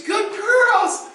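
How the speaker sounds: high loud dog praise; excited praise. Shown as a demonstration. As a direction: praise the dog with big energy.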